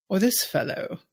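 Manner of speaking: put-on quiet British accent, charming the listener. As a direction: switch to a soft, posh-sounding British accent. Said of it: "I'm faking this accent".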